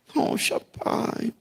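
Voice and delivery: slow, breathless whisper to the Father; deflated whisper. Retold or imitated. Preacher feeling down whispering to God. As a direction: sigh it out, worn down. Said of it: "eu fico meio brocochô".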